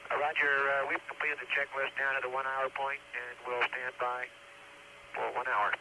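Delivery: crackly distant radio transmission; astronaut radio. Real, not acted. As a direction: spacecraft air-to-ground radio. Crew reporting over radio.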